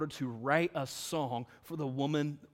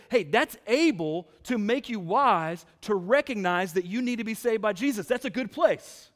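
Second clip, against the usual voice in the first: raised, louder preacher's paraphrase of Paul; pitched up, punchy and insistent; emphatic paraphrase; 6 semitones higher, 7 dB louder than usual. Retold or imitated. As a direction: voice Paul's point high and forcefully. Preacher voices Paul's message emphatically.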